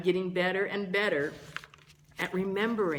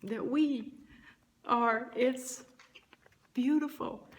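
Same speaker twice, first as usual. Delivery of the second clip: emotional; 7 semitones higher than usual. Real, not acted. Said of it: "I'm, uh, getting emotional about it".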